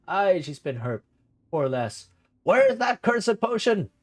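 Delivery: put-on an eccentric old uncle squinting at strangers; gruff old uncle. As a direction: bark it like a grumpy hermit uncle. Gerhard voice resumes.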